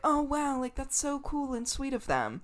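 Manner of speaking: high, softened fake admiration; mock gushing. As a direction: coo it high, as if naively impressed. Imitating gushing, uncritical public reaction.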